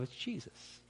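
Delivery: breathy whispered answer; whispered reveal. Real, not acted. Preacher revealing the son to the congregation, whispered.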